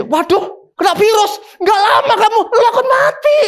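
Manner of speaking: squeaky high mock voice of a busybody; mocking gossip. Busybody telling the sick woman she will die, hammed-up high shriek.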